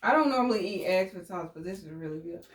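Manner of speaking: ordinary talk, nothing marked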